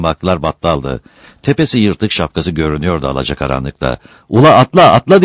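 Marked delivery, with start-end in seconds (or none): 4.3-5.2 loud, high repeated command; urgent shout. A narrator's reading. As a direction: yell to someone to jump on. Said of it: "diye bağırdı kıra". (bağırarak acele ettirme)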